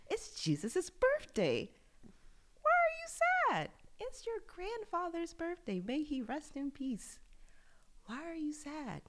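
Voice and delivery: high, lightly put-on voice of people asking why she is sad; mock questioning voice. Relatives asking her why she is sad at Christmas, high mock questioning.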